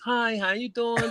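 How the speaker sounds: high, slow, sugary customer-service voice; valley girl voice. Retold or imitated. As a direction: put on a sweet fake-polite valley girl voice. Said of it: "put on the voice".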